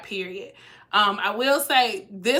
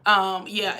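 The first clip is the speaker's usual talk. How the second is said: usual speaking voice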